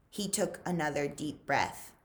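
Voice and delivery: plain reading voice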